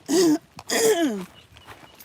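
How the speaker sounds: loud throat clearing. Real, not acted. Excuse me.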